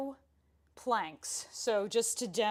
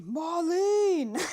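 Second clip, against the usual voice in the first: high, loud playful yell of a name; yelling for his daughter, hammed up for fun; mock scream; 7 semitones higher, 7 dB louder than usual. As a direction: belt the name like a worried dad calling out. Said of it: "Feel like I wanna scream".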